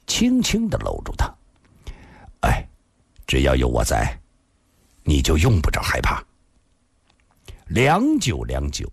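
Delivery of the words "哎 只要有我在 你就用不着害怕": low, tender comfort after a sigh; sigh, then reassure her in a low voice; sighing reassurance. A narrator's reading. Afei gently holding the crying woman, tenderly. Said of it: "阿飞长长叹了口气".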